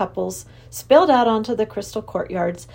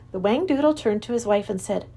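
ordinary delivery

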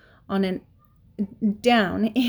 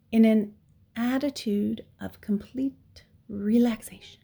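plain reading voice

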